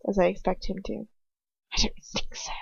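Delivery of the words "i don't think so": hushed icy aristocrat, breathed out; a sneering nobleman whispering down at her; cold whispered sneer. Acted. Lucius refuses, whispered.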